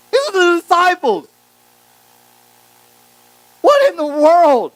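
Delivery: preacher stunned by disciples' coldness, high and loud; outraged disbelief. Real, not acted. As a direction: exclaim in shocked disbelief. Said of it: "preacher's outraged exclamation".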